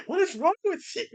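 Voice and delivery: high, loud protest; high exasperated rant. Retold or imitated. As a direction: argue it high. Acted argument.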